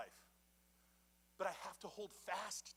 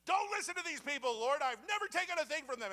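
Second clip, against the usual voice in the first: indignant plea; 9 semitones higher, 8 dB louder than usual. Retold or imitated. Moses angrily pleading to God.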